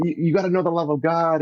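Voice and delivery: high, eager insisting; retold zealous urging; fervent plea. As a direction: keep pressing with zeal. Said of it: "And I'm".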